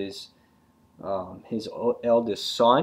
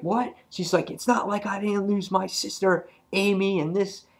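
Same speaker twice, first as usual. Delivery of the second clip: indignant; 10 semitones higher than usual. Retold or imitated. Acted quarrel between grieving characters.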